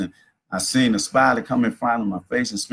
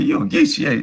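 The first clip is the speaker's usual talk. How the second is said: pitched way up, playful and knowing; teasing high guess; 3 dB louder, faster than usual. Retold or imitated. To the old lady at the library, a high teasing guess. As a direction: sing it up high like you've caught her out.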